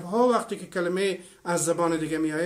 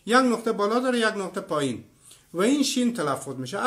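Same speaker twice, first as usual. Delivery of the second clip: ordinary talk, nothing marked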